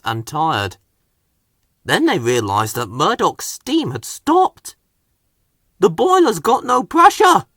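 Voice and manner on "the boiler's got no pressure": much higher, louder alarmed cry; cried alarm. Acted. Fireman crying out that the fire has gone out. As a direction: sound the alarm. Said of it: "cried the fireman".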